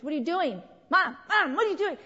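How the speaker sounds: put-on high, loud pestering child; mock frantic kid demanding Mom's attention; kids calling out. Acts kids calling out to mom.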